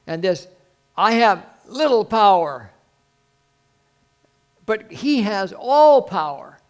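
loud, high, drawn-out proclamation; full-force preaching. Acted. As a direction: preach it out big, stretching the key words.

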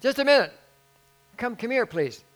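high, loud imitated hail; calling him over. Man summoning the departing pastor, calling out. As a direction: call out urgently to stop him.

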